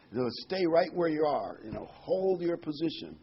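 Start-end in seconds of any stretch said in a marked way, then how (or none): none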